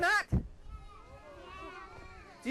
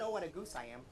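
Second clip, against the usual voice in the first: quiet punchline; 10 semitones lower, 12 dB quieter than usual. Shown as a demonstration.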